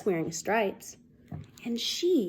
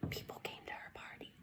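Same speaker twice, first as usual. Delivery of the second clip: soft relieved whisper; 21 dB quieter, faster than usual. A narrator's reading. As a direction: breathe it out softly. Narrator whispers happy ending.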